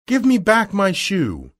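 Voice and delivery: put-on high-pitched demand from an angry girl; angry cry. A narrator's reading. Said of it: "The little girl was very angry. She cried to the witch".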